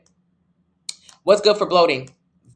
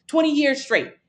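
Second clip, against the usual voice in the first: high and a bit louder on "Twenty"; emphatic; 9 semitones higher, 4 dB louder than usual. Real, not acted. Stressing how long.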